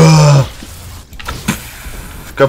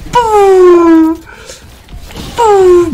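high mock explosion noise. Real, not acted. Blowing up houses.

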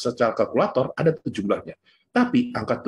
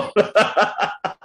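high laugh at his own joke; hearty laugh; 7 semitones higher than usual. Real, not acted. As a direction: burst out laughing at himself. (tertawa lepas)

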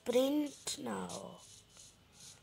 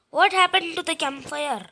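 raised, high and loud, baffled; loud confused call; 6 semitones higher, 8 dB louder than usual. Real, not acted. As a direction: shout it in disbelief. Campfire vanished.

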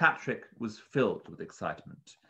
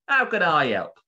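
louder, higher bird keeper voice; pleasant bird keeper; 8 semitones higher, 8 dB louder than usual. A narrator's reading. Bird keeper welcomes Mister Tweezer to the shop, pleasantly. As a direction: greet a customer as a pleasant shopkeeper. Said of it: "pleasant bird keeper".

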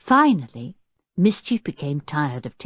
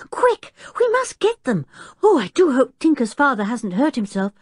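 high, urgent, slowed start; 9 semitones higher than usual. A narrator's reading. George urging the others to rescue the gear, high urgent. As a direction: hurry the others along.

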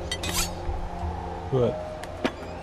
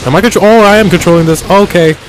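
panicked, loud and high-pitched; freaking out as enemies close in; scared horror game streamer; 5 semitones higher, 3 dB louder than usual. Real, not acted. Panicking as game chaos erupts.

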